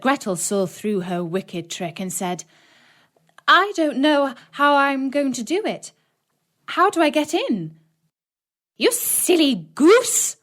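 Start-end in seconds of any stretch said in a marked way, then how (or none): 3.5-7.8 feigned innocence. A narrator's reading. Gretel feigns ignorance. 8.8-10.4 high, slow witch scoffing; scornful witch. A narrator's reading. Witch scolds Gretel.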